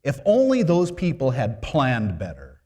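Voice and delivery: loud, high, exasperated mock complaint; mock critical Mary. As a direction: scold the couple with theatrical dismay. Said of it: "She didn't say".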